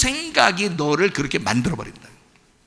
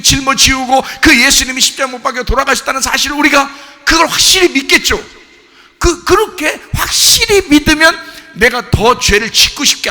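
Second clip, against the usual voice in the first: raised, driving sermon voice; fervent preaching; 9 semitones higher, 6 dB louder than usual. Real, not acted. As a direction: build conviction with insistent high pitch. Preaches at full force.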